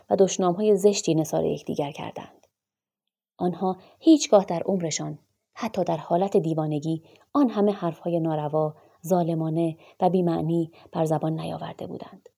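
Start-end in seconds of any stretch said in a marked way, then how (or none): none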